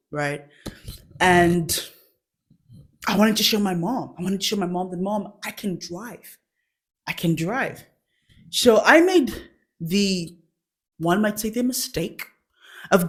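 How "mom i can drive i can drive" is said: soft boast. Retold or imitated. Showing mom she can drive.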